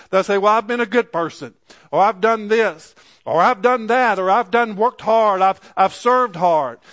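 rising, impassioned sermon delivery; preaching. Acted. Pastor preaching to his church, fervent and building.

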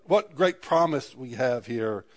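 normal talking voice

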